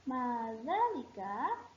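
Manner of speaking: Ma dzalika said slowly with a rising end; teacher's slow rising Ma dzalika; slow model phrase. Shown as a demonstration. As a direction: drag out Ma dzalika for the class to copy. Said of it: "Ikuti ustazah".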